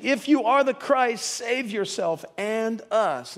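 high, loud, drawn-out jeer; desperate taunt. Acted. Criminal on the cross challenging Jesus, loud taunting demand. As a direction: demand it mockingly, pitched well up.

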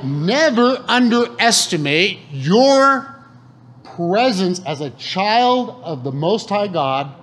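never underestimate, stretched and weighty; slow emphatic preaching. Acted. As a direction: drive it home to the congregation. Preaching climax.

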